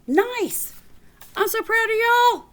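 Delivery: high, loud, proud and delighted; excited praise. Real, not acted. Teacher cheering on her class, excited and high.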